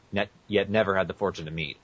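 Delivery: normal talking voice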